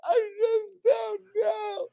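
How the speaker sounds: very high, drawn-out panicked cry; hysterical wailing. Acted. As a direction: wail helplessly, high and slow. Cornered man, acted by game master.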